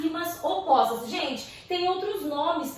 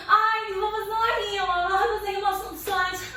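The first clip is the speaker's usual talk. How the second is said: a hint of a higher-pitched fussy poet; mock poet; 6 semitones higher than usual. Teacher mimicking poets discussing soante rhyme, lightly mocking. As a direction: lightly act out a poet's objection, pitched up.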